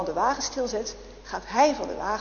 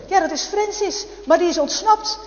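higher, louder insistence; insistent sister; 7 semitones higher, 6 dB louder than usual. Acted. Sister to her husband about reporting Francis, loud and insistent. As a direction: press it on your husband.

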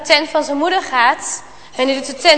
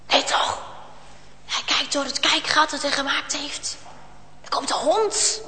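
much softer, tense narration; soft, fearful storytelling; suspenseful hush; 3 semitones lower, 11 dB quieter than usual. A narrator's reading. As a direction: build quiet suspense. Hiding, hearing a noise.

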